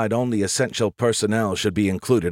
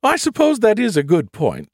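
musing; 12 semitones higher, 4 dB louder than usual. A narrator's reading. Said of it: "she mused".

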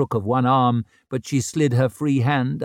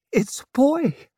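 high, tearful joy; smiling and crying; 13 semitones higher than usual. A narrator's reading. New mother tells the father, crying happily. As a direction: smile through tears, voice lifted high. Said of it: "smiling and crying at once".

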